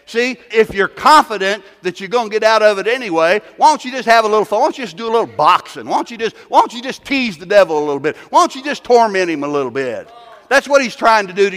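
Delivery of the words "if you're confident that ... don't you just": ordinary talk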